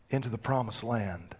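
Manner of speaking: normal talking voice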